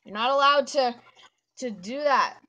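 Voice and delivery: high, very loud protest; loud scolding. Real, not acted. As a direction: cry out a loud, high-pitched no.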